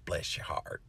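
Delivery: "Bless your heart" breathed out as a soft whisper. Shown as a demonstration. Explaining the Southern saying to viewers, whispered. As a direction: drop to a hush for the Southern saying. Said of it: "a saying down here in the South".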